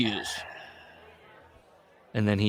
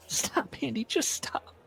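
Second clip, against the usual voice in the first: mild tearful plea, softer, half whispered; crying girlfriend; 7 dB quieter than usual. Acted. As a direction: beg him to stop, softly and near tears. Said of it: "She's crying".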